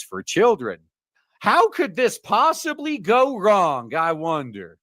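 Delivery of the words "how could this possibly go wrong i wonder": sarcastic. Real, not acted. Sarcastic rhetorical question.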